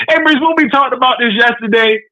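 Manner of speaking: normal talking voice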